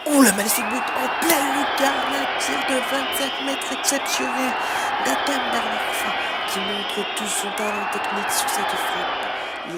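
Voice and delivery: loud, high goal shout; excited goal call. Real, not acted. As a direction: roar at the top-corner strike. Goal, commentator. (annonce de but survoltée)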